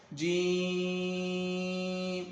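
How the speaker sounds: جیم with a very long held "ee"; long madd. Shown as a demonstration. Teacher models five-count stretch, جیم held very long. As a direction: chant جیم slowly on one steady note. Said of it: "پانچ الف کھینچیں گے".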